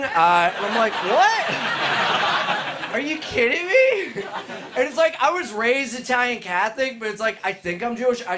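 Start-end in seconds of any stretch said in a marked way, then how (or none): 0.9-4.2 higher-pitched, incredulous retort; mock disbelief. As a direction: act out baffled disbelief. Acted disbelief at being doubted.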